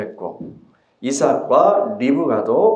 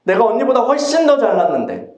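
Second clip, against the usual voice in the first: frustrated Rachel; 8 semitones higher than usual. Acted. Rachel complains she cannot conceive.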